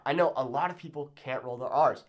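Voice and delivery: normal talking voice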